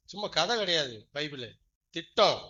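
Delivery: usual speaking voice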